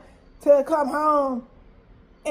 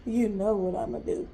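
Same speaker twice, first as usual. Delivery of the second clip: low ominous; 6 semitones lower, 8 dB quieter than usual. Retold or imitated. Repeats plan, ominous.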